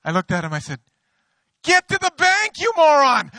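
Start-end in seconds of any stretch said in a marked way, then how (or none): 1.6-3.3 very high, loud comic shout; mock yell. Pastor joking how he reacted to the usher, mock yell. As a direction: bark it like an exasperated boss.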